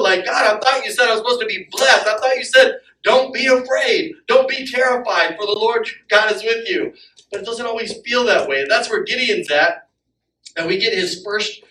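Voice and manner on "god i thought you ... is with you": complaining to God. Pretend. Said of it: "that's how we feel".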